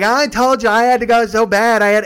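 loud, high mock whine; whiny protest. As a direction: act out a defensive passenger yelling back. Ron protests back at girls.

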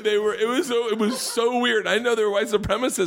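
high, laughing telling. Real, not acted. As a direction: talk through giggles. Laughing about supremacist fans.

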